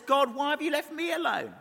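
imitated high cry of abandonment; Jesus. Preacher voicing the dying Jesus, high crying question. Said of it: "as he dies, says".

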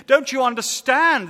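frantic, shaking him awake; panicked shout. Acted. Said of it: "he panicked".

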